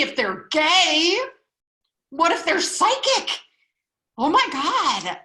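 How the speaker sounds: loud, high, scandalized outburst; mock horror. Mock horror at breaking rules.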